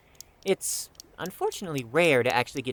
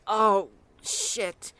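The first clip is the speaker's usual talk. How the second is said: sudden realization; 6 semitones higher than usual. Acted. Suddenly remembers the lost ball.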